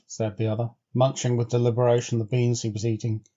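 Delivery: plain reading voice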